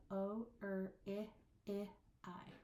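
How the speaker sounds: "O, er, I, I, I" spoken one at a time; isolated vowel sounds. Shown as a demonstration. Teacher recapping the five vowels, isolated and spaced. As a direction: space out each vowel evenly. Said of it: "So you have".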